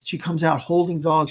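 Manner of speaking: ordinary talk, nothing marked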